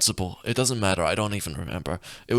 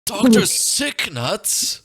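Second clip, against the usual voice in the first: incredulous; 9 semitones higher, 3 dB louder, much slower than usual. Real, not acted. Disbelief at name.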